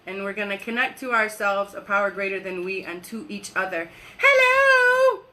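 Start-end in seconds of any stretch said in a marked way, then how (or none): 4.2-5.2 loud, high, joyful hello; excited greeting. Real, not acted. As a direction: call out hello brightly to new viewers. Greeting viewers joining the live.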